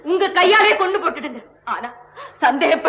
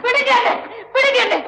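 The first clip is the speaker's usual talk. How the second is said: higher, tearful cries to let go; crying out; 6 semitones higher than usual. Acted. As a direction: plead through tears while struggling. Mohana struggles, crying for release.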